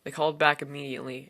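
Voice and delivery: ordinary delivery, nothing marked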